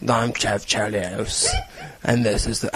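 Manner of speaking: low, soft, slow British tough-guy mumble; gruff action hero impression. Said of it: "I think I just got a new impression".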